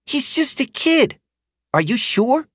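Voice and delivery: put-on high, questioning girl voice; unsure rider; doubtful rider girl.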